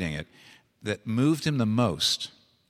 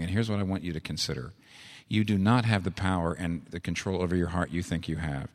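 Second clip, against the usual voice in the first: usual speaking voice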